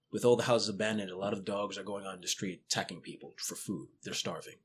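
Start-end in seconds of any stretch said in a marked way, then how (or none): none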